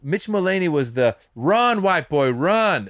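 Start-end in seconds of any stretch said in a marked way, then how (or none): 1.3-2.9 mock yell. Quoting comedian's bit.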